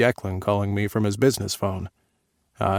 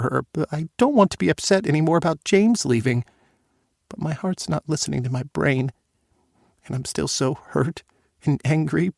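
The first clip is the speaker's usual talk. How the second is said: put-on higher, tearful young woman's voice; upset daughter; 6 semitones higher than usual. Daughter pouring out her hurt to her father, tearful. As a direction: sound young, hurt and close to tears.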